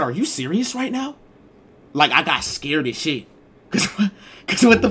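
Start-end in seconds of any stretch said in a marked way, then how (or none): 0.0-1.2 high, incredulous quote; disbelieving instructor. Retold or imitated. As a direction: pitch it up like she can't believe it. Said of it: "she said".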